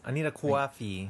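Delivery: slow, drawn-out Long Island accent on "coffee". Shown as a demonstration. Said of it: "Is that Long Island?".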